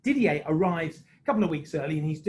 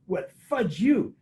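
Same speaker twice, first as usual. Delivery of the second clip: retold insult; 4 semitones higher, 3 dB louder, slower than usual. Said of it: "I hear this guy goes".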